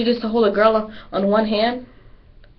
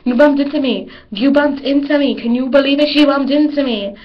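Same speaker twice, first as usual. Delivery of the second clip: loud, higher mock outburst; negative complaining; 6 semitones higher, 7 dB louder than usual. Cheerleader acting the rude version of herself, loud mock outburst. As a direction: make a scene over a bump. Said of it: "where I said negatively".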